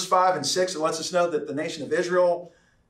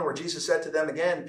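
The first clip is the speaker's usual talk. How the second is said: ordinary talk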